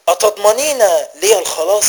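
loud, high rhetorical question; impassioned challenge. A narrator's reading. Son asks his mother to guarantee his salvation, impassioned challenge. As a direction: raise the voice sharply in protest.

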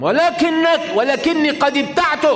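very high, loud counterclaim; acted insistence. Acted insistence in sale dispute.

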